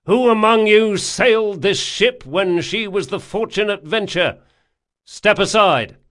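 loud, high shouted question; shouting. A narrator's reading. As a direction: shout across the deck. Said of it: "he shouted".

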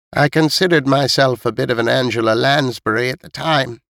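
put-on high-pitched elderly storyteller; elderly neighbour listing past deaths, pitched high; old woman recounting. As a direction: pass on old building gossip in a high old-lady voice. Voices old Missus Knight.

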